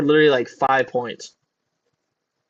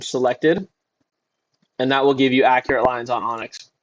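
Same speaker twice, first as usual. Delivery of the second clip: normal talking voice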